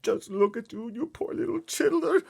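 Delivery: very high, loud, pitying; pitying cry. Acted. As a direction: cry over the poor little girl. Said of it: "cried the B F G".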